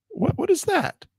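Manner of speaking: very high, baffled squeak; real startled disbelief. Real, not acted. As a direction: react to the eraser going wrong. Eraser suddenly changes.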